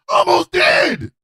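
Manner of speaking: high, loud, drawn-out protest; exclaimed retort. Acted. Answering Chaos asking did you die, high loud indignant shout. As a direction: shout it back in comic outrage.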